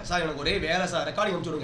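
low, soft mock pleading; mimicked meek excuse. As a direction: imitate a trainee making excuses, hushed and low. Mimics trainee's excuse asking for recording.

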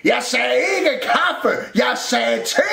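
high, insistent cross yell; grumpy shout. A narrator's reading. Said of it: "råbte Rumle surt". (råber surt)

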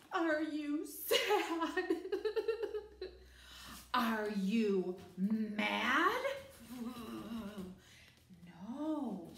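slow, drawn-out questions with a laugh; exaggerated teacher voice. Shown as a demonstration. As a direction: overact each feeling for a small child. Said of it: "right, I say".

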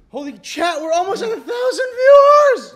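high, very loud hype scream; real over-the-top streamer excitement; excited shout. Real, not acted. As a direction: scream the milestone news to the stream. Livestream nearing viewer milestone.

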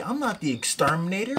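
ordinary talk, nothing marked

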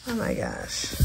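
ordinary talk, nothing marked

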